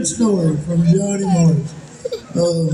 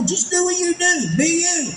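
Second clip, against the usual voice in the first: high-pitched imitation of an insistent director; retold exasperated urging; 9 semitones higher than usual. Director urges the worried voice actor, mock exasperation. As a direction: push the advice out in a much higher pitch.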